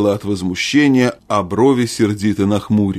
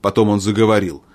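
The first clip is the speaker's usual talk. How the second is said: ordinary delivery, nothing marked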